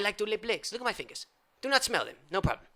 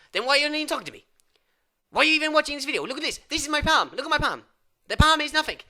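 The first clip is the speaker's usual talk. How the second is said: mock rant; 7 semitones higher, 6 dB louder, faster than usual. Ranting at viewer.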